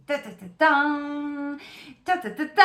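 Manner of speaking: playful sung ta ta ta ta, building up; mock fanfare. To her viewers, teasing the reveal with a playful ta ta ta ta. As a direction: hum a little drumroll before the card reveal. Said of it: "Je sais pas pourquoi je vous fais ça".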